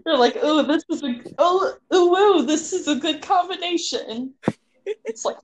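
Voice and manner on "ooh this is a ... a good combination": hammed-up, higher-pitched swooning; mock cooing delight. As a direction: coo over it like a delighted foodie. Mock cooing over a food combination.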